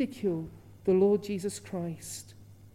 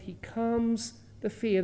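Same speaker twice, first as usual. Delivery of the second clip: ordinary talk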